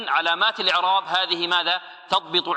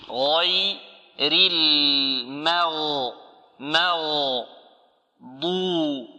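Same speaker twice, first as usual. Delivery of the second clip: slow-motion tajweed demo; very slow recitation; much slower than usual. Shown as a demonstration. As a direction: stretch المغضوب syllable by syllable. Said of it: "teacher recites, stretching letters".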